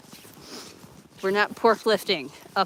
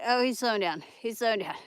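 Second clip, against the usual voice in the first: usual speaking voice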